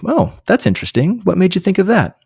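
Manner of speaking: light inquisitive lilt; curious tone. Shown as a demonstration. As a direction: sound genuinely curious about their view. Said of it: "you say".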